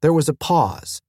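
ordinary delivery

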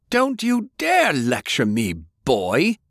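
put-on high, roaring old man's rebuke; furious shout. Grandfather shouting down his grandson, furious. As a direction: bellow him down. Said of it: "Cornelius shouted so loudly".

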